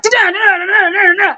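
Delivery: high rattling "da-da" run; imitated fight noise.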